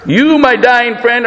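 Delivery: ordinary talk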